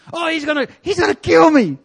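high, loud mock panic; mock fear. Preacher voices a believer fearing God's judgment, in mock panic. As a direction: cry out as if dreading judgment. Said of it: "you feared God".

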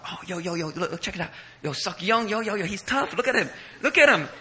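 hushed chatter rising in pitch and volume; excited kid gossip, building; impressed friends, imitated. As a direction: mimic schoolboys buzzing in amazement. Friends marvelling at his toughness.

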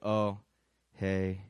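low, deflated mumbled apology; slow and downcast, all fight gone; bummed out. Acted. Dejected warlock apologizing to the woman, low and slow. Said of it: "really kind of bummed out".

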